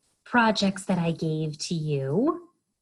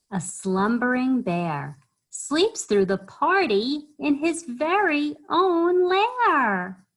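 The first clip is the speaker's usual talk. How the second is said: raised, singsong storytime lilt; children's story reading; 8 semitones higher, 5 dB louder, slower than usual. A narrator's reading. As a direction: read in rhythm to little ones.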